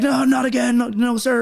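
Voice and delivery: high, hurried mock denial; protesting recruit. Recruit denying it to drill instructors, high mock protest.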